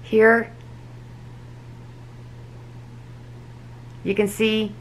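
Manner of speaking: talking through clenched teeth; finger pulling lip aside. Real, not acted. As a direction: lip held back by finger. Seen 0: Fingertip holds lip back from clenched braced teeth while speaking.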